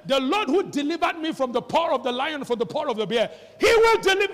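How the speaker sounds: loud, high, climbing to a cry; fiery sermon shout; preaching at full force. Acted. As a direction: thunder it from the pulpit.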